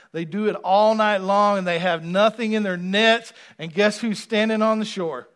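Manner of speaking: loud, rising preacher cadence; preaching at full force. Acted. Preacher building to the shore image, loud and fervent. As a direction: drive it home to the pews.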